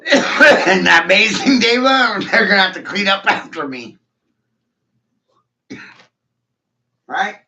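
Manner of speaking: loud, laughing taunt pitched high; gleeful gloating. Acted. Gloating to Dave about the mess, loud and laughing.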